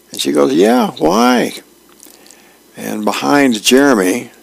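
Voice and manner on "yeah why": lightly voiced teen girl, higher; a higher put-on girl's voice, innocent. Girl answers in comic voice.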